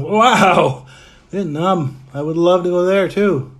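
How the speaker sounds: high, loud, wowed enthusiasm; real delight. Real, not acted. Streamer greeting a viewer from Vietnam, delighted.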